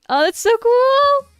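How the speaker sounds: high, slow gushing praise; excited. Real, not acted. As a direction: stretch it out, delighted and impressed. Excited reaction to a bilingual friend.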